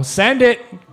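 loud hyped shout; frat bro. Real, not acted. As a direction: pump up the crowd, loud. Cheering giveaway toss.

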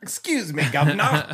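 high-pitched hammed-up Cockney gent; overdone London "guv'nor" voice; mock London accent. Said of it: "just say something like you were talking".

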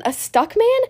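high, rising puzzled question. Acted. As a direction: repeat it in disbelief. Stickman hears the voice.

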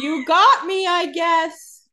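high, loud, put-on shrug of a voice; mock defeat. Co-host voicing Catherine caught out, high and loud. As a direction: give up in a cartoonish pout.

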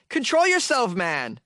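high-pitched mock outcry, dropping on "man"; exasperated scolding. Podcaster telling orgy-goers off, mock exasperated. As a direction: scold them in comic disbelief.

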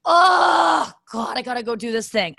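a loud, high, exasperated groan on "Oh, God"; dreading groan. Retold or imitated. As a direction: moan about a chore you dread, hammed up. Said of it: "make that noise".

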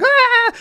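a high shrieked "Ah!" imitating the actress catching fire; hammed-up burning scream; mock scream. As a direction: squeal it up high like a bad horror scream. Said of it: "She's like".

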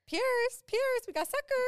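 high, loud call across a store. Pretend. Calling the runaway toddler.

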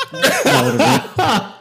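laughing. Real, not acted. Laughing at the mix-up.

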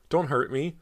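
high, loud frightened plea; begging. A narrator's reading. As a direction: plead in fear of being hurt. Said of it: "Cody begged".

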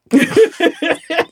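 a burst of bright high laughter. Real, not acted. Laughing after own point.

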